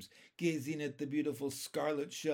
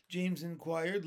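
ordinary delivery, nothing marked

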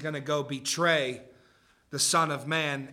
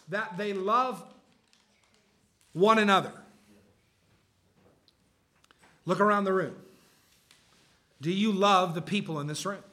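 higher and louder, driving the point home; full-force preaching; 4 semitones higher, 3 dB louder than usual. Acted. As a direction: preach it out to the whole congregation.

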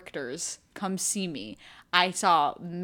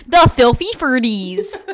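loud; 4 semitones higher, 13 dB louder than usual. Real, not acted. Repeating the joke phrase.

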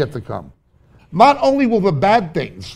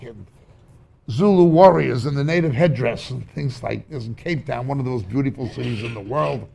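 ordinary talk

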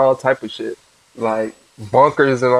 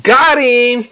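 excited; 9 semitones higher, 4 dB louder than usual. Real, not acted. Gloating over a caught suspect.

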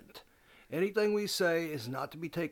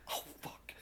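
whispered curse; 13 dB quieter than usual. Retold or imitated. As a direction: caught out, breathing the curse under his breath. Caught out on the phone.

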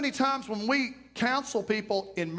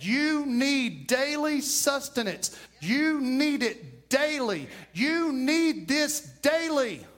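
slow, deliberate, higher; slow emphatic repetition; 4 semitones higher, slower than usual. Real, not acted. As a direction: hammer it home slowly. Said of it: "repeated slow emphasis".

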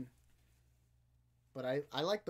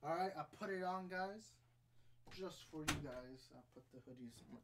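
out of breath; 2 semitones higher, 7 dB quieter than usual. Real, not acted. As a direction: catch your breath between words. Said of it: "Man, I'm out of breath 'cause I sprinted over there".

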